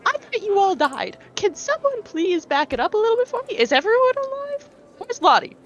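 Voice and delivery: stunned friend who thought them dead; shocked disbelief. Acted. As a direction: demand answers in a panic. Player's character in shocked disbelief.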